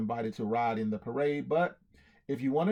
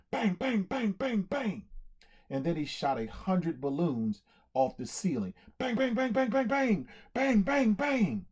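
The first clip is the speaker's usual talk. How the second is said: gunshot noises; 5 semitones higher than usual. Acted. Gunshots imitated.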